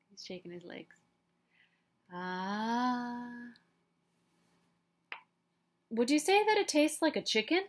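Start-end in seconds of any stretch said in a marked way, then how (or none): 5.9-7.6 a quick, lightly voiced curious duckling; pestering duckling. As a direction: rattle off the question innocently. Duckling presses with questions.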